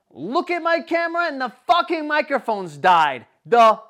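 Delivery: high, loud exasperated outburst; worked-up storyteller's rant; retold seething rage. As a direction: relive the fury at full volume. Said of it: "I'm seething, bro".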